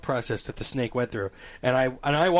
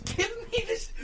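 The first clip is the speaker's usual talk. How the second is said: very high, softer mock squeal; stupid voice; 7 dB quieter than usual. Storyteller mimics his friend demanding a tool, high silly voice. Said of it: "he's like, he goes".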